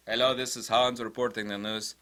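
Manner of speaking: put-on low, stiff German newsreader accent; deadpan German accent parody; mock German accent. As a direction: play a humorless German anchor reading news. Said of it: "I'm half German. Sometimes I can make fun of the Germans".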